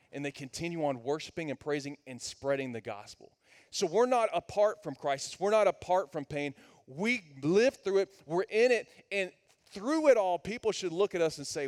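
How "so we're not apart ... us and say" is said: louder and higher, driving sermon voice; full-force preaching. Acted. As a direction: preach it hard to the room.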